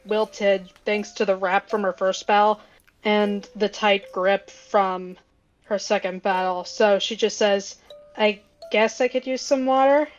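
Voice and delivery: ordinary delivery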